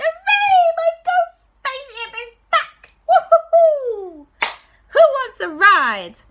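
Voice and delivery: very high, loud joyful whoops; bursting with happiness; overjoyed ghost. Acted. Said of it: "beside himself with joy".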